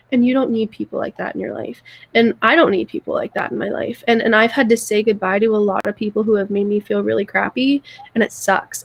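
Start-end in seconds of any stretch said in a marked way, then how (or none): none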